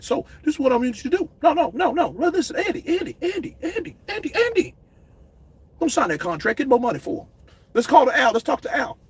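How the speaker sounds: a touch of panicked pleading; frantic manager. Retold or imitated. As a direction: stop him before he signs. Manager frantically stopping a deal.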